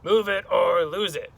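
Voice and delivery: forceful classroom command; stomping teacher. Acted. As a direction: bellow it like a stomping monster teacher. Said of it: "Miz Kirby stomped".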